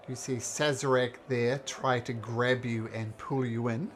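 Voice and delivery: ordinary delivery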